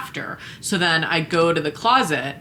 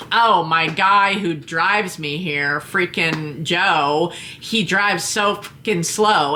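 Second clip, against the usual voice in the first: drawled excuses; much slower than usual. Retold or imitated. Imitating volunteer's excuses.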